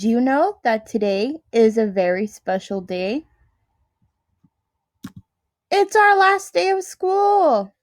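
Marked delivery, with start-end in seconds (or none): 5.7-7.7 high, bright-eyed reveal of the big day; excited announcement. Real, not acted. Teacher telling first graders it's the last day, excited. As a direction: lift it up like a happy surprise.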